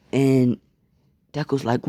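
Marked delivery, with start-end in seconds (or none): none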